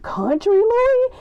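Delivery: high, drawn-out disbelieving question; incredulous. Acted. Fan who never experienced country Louis, incredulous squeal.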